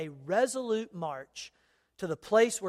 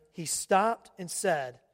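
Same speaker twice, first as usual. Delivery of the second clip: ordinary talk, nothing marked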